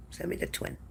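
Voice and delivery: whispered joking plea, almost to herself; soft voiceless aside; playful whisper. Real, not acted. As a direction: breathe it like a secret wish. Playful aside wishing for a twin.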